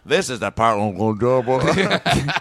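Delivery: slow resigned cartoon grumble; weary dinosaur voice. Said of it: "he goes".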